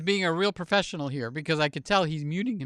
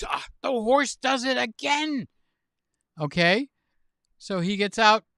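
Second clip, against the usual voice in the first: ordinary delivery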